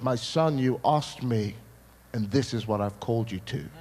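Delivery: low, solemn divine voice; voice of God. Acted. Being of light addresses the boy, low and solemn.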